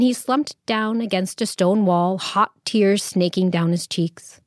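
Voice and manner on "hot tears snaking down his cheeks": ordinary delivery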